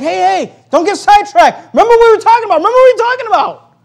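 high, loud insistent calling; urgent reining in. Acted. Jesus calling sidetracked disciples back, urgent and loud. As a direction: cut through their excitement, loudly.

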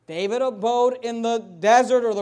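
loud, slow preacher's rise; preaching. Acted. Preacher stressing where David lived. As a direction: stretch each word out.

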